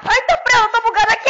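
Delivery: high, loud panicked cry; panicked shout. Real, not acted. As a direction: yell in alarm at being stuck. Stuck in the game.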